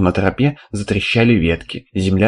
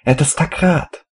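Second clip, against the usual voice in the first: put-on high, slow young boy's voice; thin boyish voice; 7 semitones higher, slower than usual. A narrator's reading. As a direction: pitch it up like a boy. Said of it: "неожиданно тонким мальчишеским голосом".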